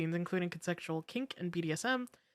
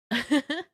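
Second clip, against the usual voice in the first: cartoon laugh; 7 semitones higher, 5 dB louder than usual. Real, not acted. Laughter after raid.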